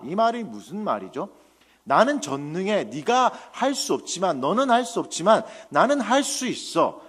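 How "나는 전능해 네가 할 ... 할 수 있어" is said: higher, louder preaching reproach; God rebuking. Retold or imitated. God scolding Abraham for not trusting him, stern rebuke. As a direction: build into a forceful, pleading scolding. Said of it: "하면서 책망하시는 겁니다".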